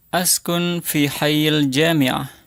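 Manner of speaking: slow, careful model sentence for repeating; slow model phrase. Shown as a demonstration. Teacher modelling a sentence slowly for students to repeat. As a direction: say it slowly for the class to copy. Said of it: "nanti ulangi setelah saya".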